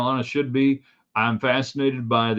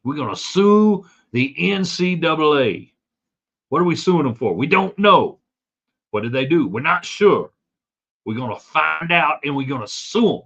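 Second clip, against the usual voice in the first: hammed-up Southern voice, somewhat higher; Southern accent; 4 semitones higher than usual. Host lampooning a governor's lawsuit in a mock Southern accent. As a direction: play a hokey Southern politician, lightly. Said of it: "West Virginia does not talk like somebody in the South".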